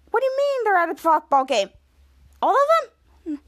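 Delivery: high-pitched disbelief, climbing on the last question; incredulous. Retold or imitated. Man on emergency call hears firefighters are away, incredulous. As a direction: act out being floored by the news.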